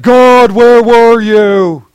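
high, loud, drawn-out wail at God; accusing plea to God. Pretend. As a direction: cry out slowly like you were abandoned. Complaining to God after trouble.

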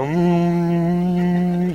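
a lightly imitated little mm of pleasure; child's happy mm. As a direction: hum a contented mm after each bite. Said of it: "she would eat and go".

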